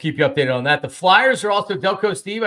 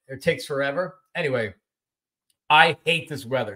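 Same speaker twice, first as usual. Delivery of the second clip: usual speaking voice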